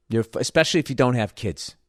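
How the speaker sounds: normal talking voice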